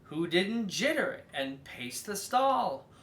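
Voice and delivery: usual speaking voice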